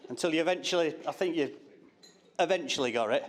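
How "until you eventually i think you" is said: ordinary talk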